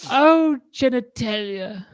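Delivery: high, slow, grand-lady drawl; high society dame. Pretend. Dame greeting the uncle, high and slow. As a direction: swoon it like a posh dame. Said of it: "this is how she speaks to the uncle".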